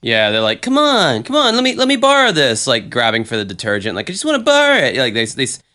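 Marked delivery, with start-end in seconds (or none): 0.6-2.6 high teasing pest voice; playful flirting. Retold or imitated. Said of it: "a playful flirtation". 4.1-5.0 high teasing pest voice; playful flirting. Retold or imitated. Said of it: "a playful flirtation".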